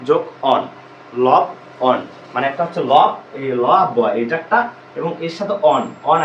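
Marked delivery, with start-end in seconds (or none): 0.1-2.0 লবণ broken into লব and অন with clear pauses; syllable split. Shown as a demonstration. Teacher splits লবণ for students, syllable by syllable. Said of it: "লবণ শব্দটা উচ্চারণ করলে".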